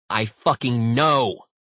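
loud, slow, exasperated outburst; angry snap. A narrator's reading. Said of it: "Brooks snapped".

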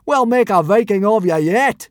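loud, high, hearty bellow; roaring. A narrator's reading. Teacher roaring approval at his pupil, loudly. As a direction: bellow encouragement. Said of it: "roared Gobber the Belch".

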